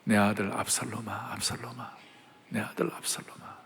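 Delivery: broken, breathy whisper of a father's lament; grieving whisper. Acted. King David whispering his grief for his son. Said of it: "그 순간 다윗이 어떻게 했는지 아세요?".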